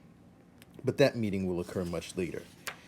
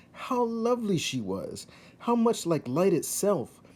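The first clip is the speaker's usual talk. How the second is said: rapt narration; 10 semitones higher, 4 dB louder than usual. A narrator's reading. Narrator describing the princess to the reader, rising high in awe. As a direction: pitch climbing high in wonder.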